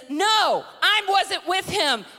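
loud, high, drawn-out shouted denial; hammed-up angry outburst. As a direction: shout it as a furious protest. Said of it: "He cusses and says".

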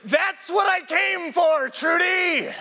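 high, strained, drawn-out yell; imitated shrieking patient in ecstatic pain; near-tears scream. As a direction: scream it as if about to cry. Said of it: "this man is about to start crying".

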